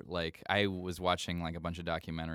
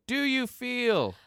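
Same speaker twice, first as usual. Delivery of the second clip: high, slow, sustained computer voice; drawn-out robotic sung question; 6 dB louder, much slower than usual. Retold or imitated. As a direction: imitate a singing talk-box effect. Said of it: "always does the computer voice".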